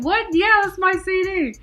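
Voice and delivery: excited reply. Retold or imitated. She tells the guy the CD is hers, high and loud. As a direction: claim it brightly.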